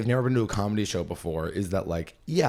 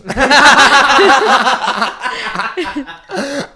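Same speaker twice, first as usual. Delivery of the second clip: high, loud extended laughter; helpless laughing fit; big high laugh; long loud laugh; 13 semitones higher, 13 dB louder than usual. Real, not acted. Laughing at own story.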